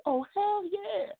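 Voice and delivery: over-the-top put-on yes; mock hype answer. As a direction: stretch it out like a cartoon cheer. Answers own rhetorical question.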